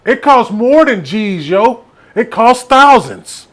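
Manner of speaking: put-on slow clueless teen insisting he's right; dumb New York kid. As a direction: imitate the clueless teammate, slow. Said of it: "the young fella from New York was like".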